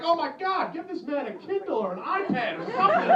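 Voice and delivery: high-pitched mock outrage; mock shock. Shocked onlooker reacting to a man reading while driving, mock outrage. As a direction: act scandalized, pitch jumping up. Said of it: "And I was shocked".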